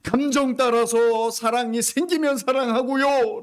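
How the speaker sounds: high, loud mimicking of a fickle believer; mock churchgoer excuse. Pastor mimicking congregants' excuses, loud and high. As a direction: throw their excuse back at them. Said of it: "라고 언제까지 하시렵니까".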